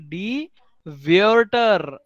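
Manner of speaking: Wörter very slow, stretched "vyur-ter"; twisted umlaut demo. Shown as a demonstration. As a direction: draw out the twisted vowel in Wörter. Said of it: "इसमें थोड़ा सा twist क्या है". (Wörter धीरे, "व्यर्टर" जैसा खींचकर)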